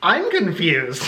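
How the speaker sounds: slow, deadpan mirror of his line; slow mocking echo. Throwing the date's words back at him, slow deadpan echo. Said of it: "And I said".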